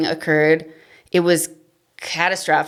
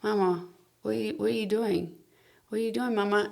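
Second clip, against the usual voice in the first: put-on a light, higher, soft little boy's voice, asking worried; gently imitating her small son's concern; toddler son's voice; 4 semitones higher, 8 dB quieter than usual. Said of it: "he was like".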